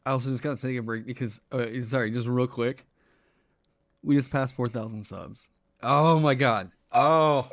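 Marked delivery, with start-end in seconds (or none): 5.8-7.5 loud, thrilled outburst; overjoyed shout at the milestone; excited. Real, not acted. Just passed four thousand subs.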